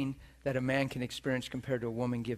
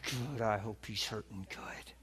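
softer, whispered bitter wish; bitter wife; 3 semitones lower, 7 dB quieter than usual. Retold or imitated. Wife far away relishes her husband's pain, whispered and bitter. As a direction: hiss out a spiteful wish in a whisper. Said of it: "she said she was so bitter".

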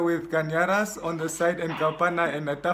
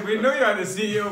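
quick, laughing jab at a friend; laughing tease; 5 semitones higher, 4 dB louder, faster than usual. Real, not acted. Teasing his friend about being CEO, laughing. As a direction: push the question while cracking up.

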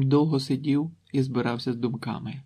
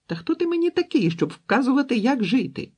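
much higher, loud screaming outburst; furious shout; 12 semitones higher, 6 dB louder than usual. A narrator's reading. Daughter screams at her father, high furious yell. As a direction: scream it at him, enraged. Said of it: "закричала дочка".

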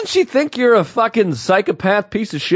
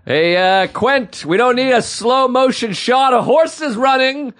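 slow complaint building to a high shout; exasperated editor; 5 semitones higher, slower than usual. Acted. Editor objecting to director's overlong movie, mock exasperated shout. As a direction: draw it out, then holler the ending.

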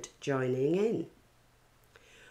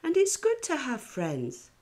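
much higher, gushing; gushing mother; 10 semitones higher than usual. A narrator's reading. Mother telling Sophie about Stephen, higher gushing voice. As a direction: coo like a delighted mom. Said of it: "said her mother".

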